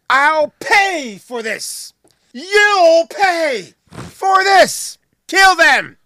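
high, slow, drawn-out angry threat; villain vow. Retold or imitated. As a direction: stretch out the threat, then shout the order. Villain Roddy vows revenge.

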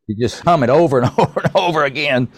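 amused, pitch rising through the laugh. Real, not acted. Host jokes about an earworm, laughing.

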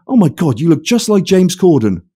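a lightly voiced fan spotting a celebrity; mock starstruck. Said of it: "And he went".

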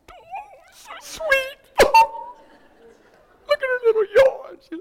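very high, loud weepy squeal; gushing tearful falsetto; re-enacted crying. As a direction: act out crying over something adorable. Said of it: "I just started crying".

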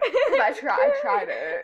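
high, loud, through laughter; laughing. Real, not acted. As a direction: squeal it out while laughing. Laughing at her own gross combo.

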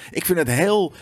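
ordinary delivery

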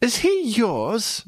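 put-on high, slow questioning boy voice. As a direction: ask it slowly, pitched high. Stubbins quoted, high and slow.

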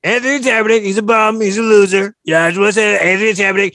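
loud, boastful Trump impression. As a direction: bluster like Donald Trump. S0 doing Trump voice.

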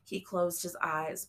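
ordinary delivery, nothing marked

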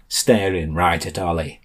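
ordinary delivery

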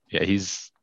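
usual speaking voice

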